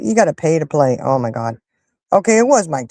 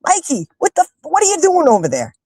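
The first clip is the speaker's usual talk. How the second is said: exasperated high-pitched mock yell; yelling at Mike; 10 semitones higher than usual. Fan scolds the boxer through the TV, mock yelling at Mike. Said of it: "I'm yelling at Mike".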